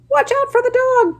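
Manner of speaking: very high sheep warning; the sheep warn about the dog, very high; sheep crying out. Acted. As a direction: cry out the warning. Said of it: "cried the sheep".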